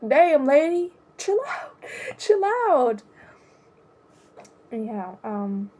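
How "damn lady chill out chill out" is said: laughing exasperation. Real, not acted. Reacting to rude receptionist.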